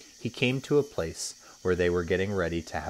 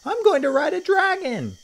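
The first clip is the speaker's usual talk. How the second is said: put-on very high, loud child's squeal; high-pitched excited child voice; excited cry; 8 dB louder than usual. A narrator's reading. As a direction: shout it like a thrilled kid. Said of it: "cried one".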